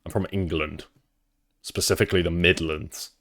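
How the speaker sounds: normal talking voice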